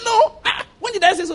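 high, laughing no; laughing denial. Retold or imitated. Teacher laughing off the question of a second wife, high and amused. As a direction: laugh the charge away in a high voice.

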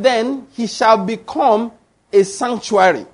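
slowly, stretching out BECOME, then a pause before "a sanctuary"; slow emphatic repeat. Shown as a demonstration. Preacher repeating the verse so they understand, slow and emphatic. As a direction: spell it out slowly, pausing after "become". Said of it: "I want you to understand that".

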